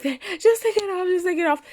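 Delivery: high-pitched urgent plea; imitated scream. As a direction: mimic dad yelling to get it off. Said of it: "he would scream out".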